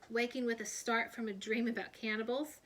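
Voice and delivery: ordinary delivery, nothing marked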